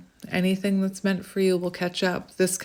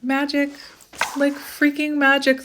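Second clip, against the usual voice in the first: excited; 7 semitones higher than usual. Real, not acted. Amazed after the card appears.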